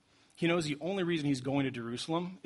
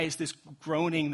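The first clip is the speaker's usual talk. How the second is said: usual speaking voice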